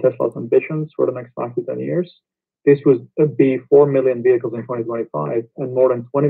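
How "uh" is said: ordinary delivery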